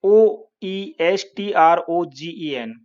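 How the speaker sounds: letters read out one by one; deliberate spelling for students; spelling out the word for the class; slow spelling. Shown as a demonstration. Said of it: "oestrogen".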